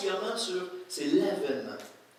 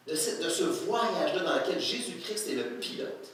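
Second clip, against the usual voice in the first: ordinary talk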